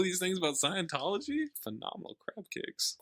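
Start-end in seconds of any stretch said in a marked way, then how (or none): 1.6-3.0 whispered aside. Real, not acted. Joking aside about Scientology.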